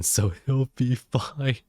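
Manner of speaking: ordinary talk, nothing marked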